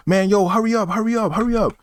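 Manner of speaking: mock the rushing customer, pitched high; impatient guy. Guy at the counter rushing the clerk, high impatient voice. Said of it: "He was like".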